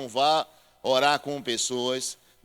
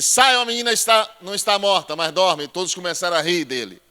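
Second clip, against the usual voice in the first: loud, higher proclamation of Jesus' command; full-force preaching; 3 semitones higher, 7 dB louder than usual. Acted. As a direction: proclaim it to the congregation.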